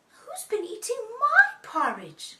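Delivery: high, loud Mummy bear; mummy's voice. Shown as a demonstration. Mummy bear finds her porridge eaten, high mummy voice. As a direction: pipe up high as an indignant mother bear. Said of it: "in a mummy's voice".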